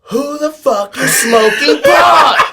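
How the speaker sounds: loud, high, slow furious yell; angry shout. Retold or imitated. Music director yelling at the kids on the bus, furious shout. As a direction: bellow it like an angry teacher catching them.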